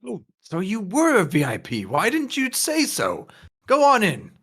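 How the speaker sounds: exaggerated change of heart for a VIP; suddenly welcoming doorman.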